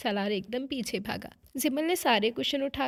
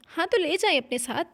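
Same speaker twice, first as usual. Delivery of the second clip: higher, louder careless brush-off; dismissive; 6 semitones higher, 7 dB louder than usual. Acted. Wife brushing off her husband about the child, dismissive. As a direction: wave him off, glad to be rid of it. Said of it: "जान छुड़वाने के अंदाज में".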